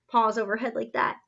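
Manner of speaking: plain reading voice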